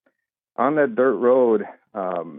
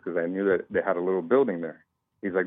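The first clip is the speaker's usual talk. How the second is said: usual speaking voice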